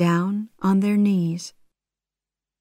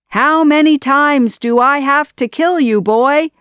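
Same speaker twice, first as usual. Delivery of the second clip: higher, louder villain shout; furious shout; 8 semitones higher, 7 dB louder than usual. Acted. Jafar shouting at Aladdin in fury. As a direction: roar it at the boy who won't die. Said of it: "Jafar shouted".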